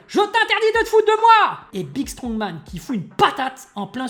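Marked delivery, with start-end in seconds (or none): 0.1-1.6 high, loud furious yell; losing it. Acted. Big Strongman raging at Cerise as he charges, loud furious yell. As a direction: blow up and charge at her. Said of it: "pète un plomb".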